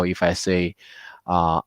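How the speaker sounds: ordinary delivery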